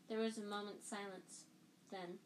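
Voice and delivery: usual speaking voice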